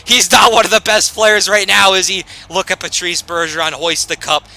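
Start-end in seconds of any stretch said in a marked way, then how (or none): 0.0-2.2 a raised, louder mock heckler's voice; sarcastic fan taunting the gamer; mocking critic. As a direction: needle yourself like a smug fan. Mock critic voice quoting a viewer.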